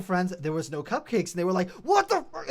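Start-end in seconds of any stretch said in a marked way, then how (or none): 1.7-2.5 high, loud indignant outburst; mock-outraged friends' complaint; mad. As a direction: exclaim it in exaggerated outrage. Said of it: "they were mad".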